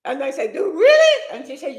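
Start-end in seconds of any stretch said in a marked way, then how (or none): 0.5-1.2 high, loud disbelief; surprised question. Retold or imitated. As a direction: squeal it as an incredulous question. Acted surprised reply on phone.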